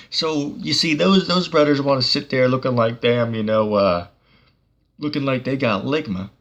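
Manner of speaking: usual speaking voice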